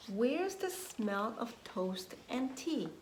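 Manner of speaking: ordinary talk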